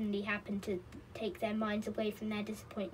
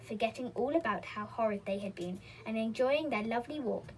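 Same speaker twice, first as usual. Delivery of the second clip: plain reading voice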